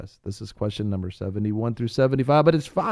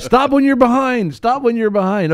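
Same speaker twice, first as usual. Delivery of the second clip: mock exasperated quote; 11 semitones higher, 8 dB louder than usual. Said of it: "John got one wrong three times".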